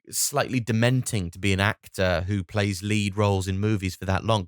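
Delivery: usual speaking voice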